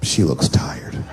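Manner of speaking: slow whispered thought; hushed aside. Retold or imitated. Husband looking at his tired wife at night, slow whisper. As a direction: whisper it to yourself. Thinking about sleeping wife.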